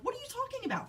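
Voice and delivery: high, startled question; alarmed mom. Retold or imitated. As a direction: shoot up in shock at the child's words. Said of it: "And I'm like".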